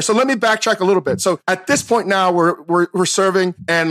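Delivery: normal talking voice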